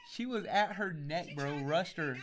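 usual speaking voice

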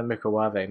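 "microwave" said as mee-cro-WAH-vay; Nigella's pronunciation. Shown as a demonstration. Telling friends the house name for it, Nigella's pronunciation. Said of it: "we refer to it as the".

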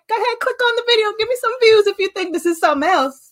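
high, fast, breezy dare; carefree sassy self. Retold or imitated. Talking to doubting viewers, brazen and breezy. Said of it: "Now I'm just like".

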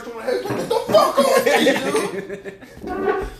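hammed-up shouting to be let go; mock angry driver. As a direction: imitate a furious racer shoving people off. Acts out angry crashed driver.

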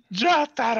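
high, loud dramatic villain cry; hammed-up anime shout, far above normal pitch; Dio. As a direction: imitate the dubbed villain yelling his rival's name. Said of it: "O jeito que ele fala Jotaro".

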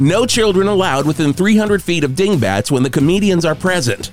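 cheesy concert calendar DJ; radio announcer. Pretend.

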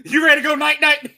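high, loud laughing taunt; laughing tease. Acted. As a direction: tease him through laughter. Teasing friend, laughing.

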